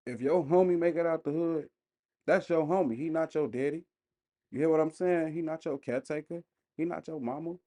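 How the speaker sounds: normal talking voice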